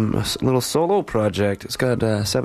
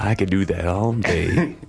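deep sexy voice; 4 semitones lower than usual. Pretend. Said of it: "in my deep, sexy voice".

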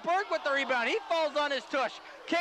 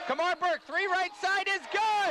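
excited call; 6 semitones higher than usual. Real, not acted. Call of a made three.

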